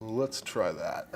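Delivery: usual speaking voice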